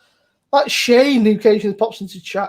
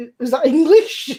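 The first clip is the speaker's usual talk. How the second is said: higher, louder, baffled mock voice; mock bafflement; 7 semitones higher, 6 dB louder than usual. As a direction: play it up, confused and incredulous. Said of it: "I was like".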